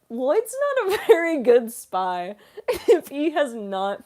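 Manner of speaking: loud, high, incredulous through laughs; laughing mock outrage. Streamer retelling their joke about a clueless spy, laughing loudly. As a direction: argue it loudly while cracking up.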